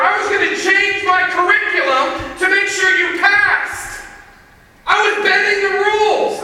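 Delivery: high, loud, indignant rant; furious outburst. Retold or imitated. Teacher exploding at Brandon who blamed him, furious shouting. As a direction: shout it in outraged disbelief, punching every phrase. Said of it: "I've never been so mad".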